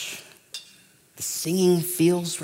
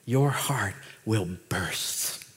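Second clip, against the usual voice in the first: hushed, slow and tender; soft and slow; 5 semitones lower, 4 dB quieter, slower than usual. Real, not acted. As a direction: let it land softly. Slow soft line before the build.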